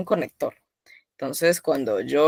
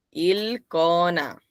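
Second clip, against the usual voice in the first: Ilcona repeated slowly and gently; slow soft demo of Ilcona; very slow, softer; 4 dB quieter, much slower than usual. Shown as a demonstration. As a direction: model Ilcona again, slow and quieter.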